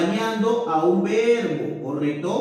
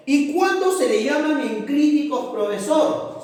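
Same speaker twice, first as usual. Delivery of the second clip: student question; 7 semitones higher than usual. Acted. Pupil asking the profesor about enclíticos, higher. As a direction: ask it as the eager student.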